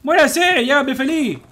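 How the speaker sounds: high, slow angry shout; slow furious yell. Real, not acted. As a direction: stretch out the yell in frustration. Gamer yelling at enemy.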